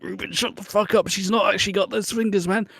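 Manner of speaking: a hint of high urgent hushing; hushing Ruben. Friends at a festival hushing their tactless mate, mildly high. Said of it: "And we're just like".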